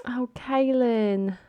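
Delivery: high, drawn-out lament; anguished dismay. Real, not acted. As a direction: plead with her in high, stretched-out dismay. Laments the bride's walkout.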